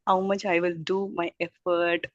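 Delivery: normal talking voice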